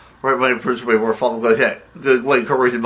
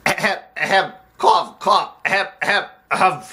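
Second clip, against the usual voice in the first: exaggerated fake coughs, pitched up; pointed throat-clearing; 4 semitones higher than usual. Visitor seeking attention, pointed fake throat-clearing. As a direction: clear your throat to get noticed.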